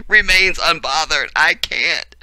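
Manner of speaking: high, loud, just after a laughing fit; tickled. Real, not acted. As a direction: say it overcome with amusement. Still tickled by the meme.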